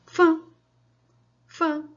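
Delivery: Fã called out loud and high, one beat; counting demo. Shown as a demonstration. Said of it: "você vai ver quantas sílabas tem a palavra fã".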